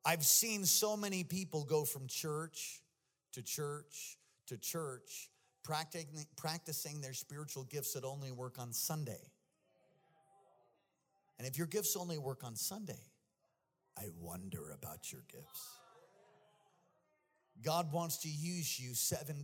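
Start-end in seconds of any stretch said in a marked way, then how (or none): 0.0-9.3 over enunciating. Shown as a demonstration. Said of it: "I'll say it slower". 14.0-15.8 whisper. Real, not acted. Pointed aside after the point.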